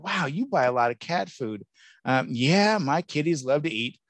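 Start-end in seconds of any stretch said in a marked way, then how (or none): 0.0-1.7 a hint of a teasing onlooker voice; lightly voiced amused coworker. Speaker retells coworkers teasing about his cart, lightly mocking. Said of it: "everyone discovered, like".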